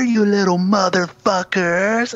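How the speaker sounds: high, slow imitated snarl; retelling Cage yelling at the kids through the door; mock Cage rant. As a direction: ham up a crazed father swearing. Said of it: "suddenly Cage just goes".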